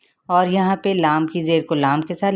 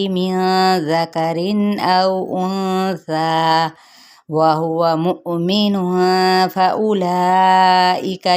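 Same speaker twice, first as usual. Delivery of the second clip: Quran recitation; 2 semitones higher, much slower than usual. Acted. Teacher reciting the verse aloud for students, slow melodic chant. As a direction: draw each vowel out in a chanted recitation.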